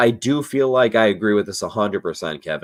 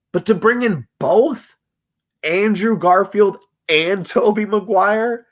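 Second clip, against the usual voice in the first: tipsy; 8 semitones higher than usual. Real, not acted. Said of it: "sorry, I'm tipsy".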